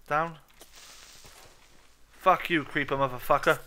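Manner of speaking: loud snarl at the monster; angry curse. Real, not acted. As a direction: shout it at the enemy. Cursing creeper loudly.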